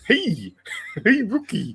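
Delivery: high laughing cartoon voice; cartoon moose voice. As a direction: squeak it out through laughter.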